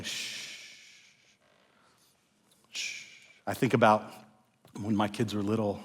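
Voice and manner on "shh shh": soft whispered shh shh; soothing shush. Shown as a demonstration. Parent soothing a crying baby, whispered shush. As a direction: hush an upset baby. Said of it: "holding a baby that's upset and just saying".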